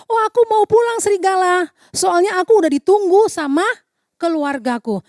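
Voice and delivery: little sheep voice. Acted. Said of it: "Dombi answers wolf".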